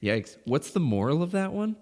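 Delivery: usual speaking voice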